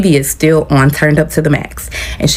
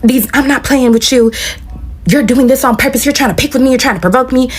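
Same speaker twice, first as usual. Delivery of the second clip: higher-pitched irritated rant, little added volume; mock yelling; 8 semitones higher than usual. Roommate accusing her of provoking on purpose, mock yelling. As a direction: imitate an accusing yell without really shouting. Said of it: "I hear her yelling".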